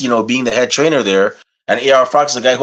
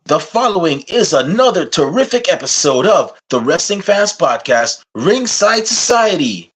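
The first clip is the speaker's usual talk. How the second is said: announcer intro; 4 semitones higher than usual. Acted. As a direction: announce the show like a ring announcer.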